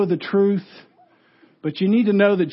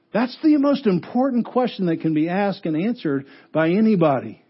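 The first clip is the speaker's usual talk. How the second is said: usual speaking voice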